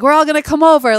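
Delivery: high and loud, hammed-up Jersey accent. An Italian relative calling everyone over, in a hammed-up Jersey accent. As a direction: announce it like a Jersey relative rallying the family. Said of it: "my Jersey accent isn't very good".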